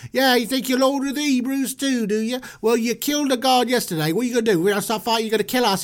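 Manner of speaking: high-pitched British heckler; taunting Hebrew. Acted. Fighting Hebrews accusing Moses, high taunting voice.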